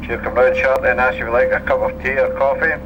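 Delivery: ordinary talk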